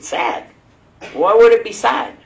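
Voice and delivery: can't believe what he just heard; incredulous. Retold or imitated. Convert shocked when brother calls Ramadan's end sad.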